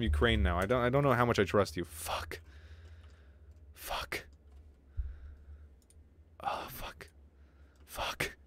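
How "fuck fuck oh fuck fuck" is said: hushed, voiceless swearing; whispered cursing. Real, not acted. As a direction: curse under your breath. Muttered swearing as game goes wrong.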